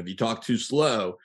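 normal talking voice

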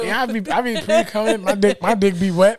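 words squeezed out through laughter; high laughing squeal of embarrassment. Real, not acted. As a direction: crack up while confessing.

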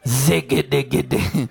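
slow burst of mock tongues; mock speaking in tongues. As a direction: imitate tongues in staccato nonsense syllables. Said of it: "You have all manner of tongues".

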